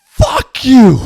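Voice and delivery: high, loud mock shout; defiant shout. Coach telling the ref off, loud high shout. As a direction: fire it back at the ref. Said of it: "looked at him and said".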